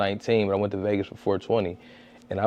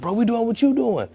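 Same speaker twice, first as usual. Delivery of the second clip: high voice for the quoted creators; high-pitched imitation; 13 semitones higher than usual. Rival creators announcing themselves to him, high-pitched imitation. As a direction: mimic them in a much higher voice.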